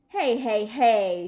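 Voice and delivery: loud, cranky hey; grouching. Acted. May to Sheldon, a loud grouchy hey. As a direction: call out like a cross little girl. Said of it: "she grouched".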